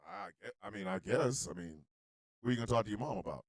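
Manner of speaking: low, very soft hedging; hushed, wary grandfather; reluctant grandpa. Acted. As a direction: stall quietly, unsure. Acting grandpa's reluctant answer.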